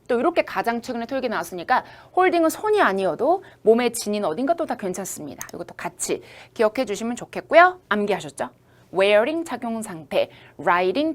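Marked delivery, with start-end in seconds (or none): none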